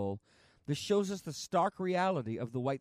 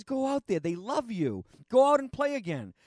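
urging stagehand shout; 8 semitones higher, 7 dB louder than usual. Retold or imitated. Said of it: "He says".

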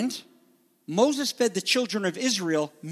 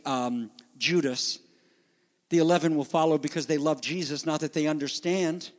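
normal talking voice

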